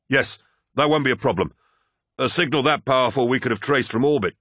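flight officer voice. Acted. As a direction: voice a tense, hurried officer.

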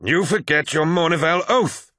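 high-pitched, accusing cry. A narrator's reading. Abaddon cries after departing brothers, accusing. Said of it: "cried Abaddon".

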